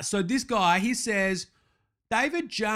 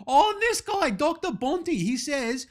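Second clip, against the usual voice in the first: indignant, high-pitched complaint; riled up; 6 semitones higher than usual. Acted. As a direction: pitch jumps up at yet another critic. Riled by yet another critic.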